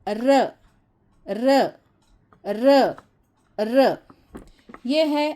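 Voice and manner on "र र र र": "र" as a short tapped ra, not "ar"; letter sound r. Shown as a demonstration. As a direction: sound out "र", repeated. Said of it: "तो इसे पढ़ोगे र".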